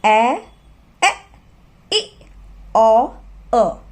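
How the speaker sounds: each short vowel ए, ए, ई, ओ, अ said clipped and separate; short vowel sounds. Shown as a demonstration. Teacher reciting the five short vowel sounds. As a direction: list the short vowels one by one. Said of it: "short sound जो हैं वो हैं".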